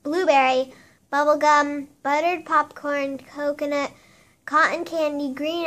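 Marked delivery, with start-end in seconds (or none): none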